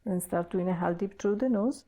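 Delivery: calm, paced breathing instructions; guided breathwork. Acted. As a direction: coach the breath step by step, unhurried. Leads a breathing exercise.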